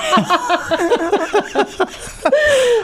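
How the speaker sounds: long high-pitched burst of laughter; real laughter. Real, not acted. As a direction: crack up at the punchline. Laughing at the drunk guy line.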